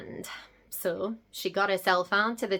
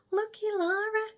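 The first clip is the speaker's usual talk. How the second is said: envious; 13 semitones higher than usual. Acted. Envious aside about cousin.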